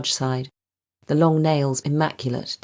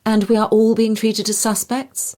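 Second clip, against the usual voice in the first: indignant question; 8 semitones higher, 5 dB louder than usual. A narrator's reading. Doctor Ajay's indignant question.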